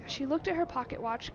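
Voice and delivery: normal talking voice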